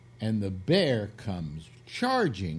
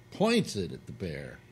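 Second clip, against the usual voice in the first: ordinary talk, nothing marked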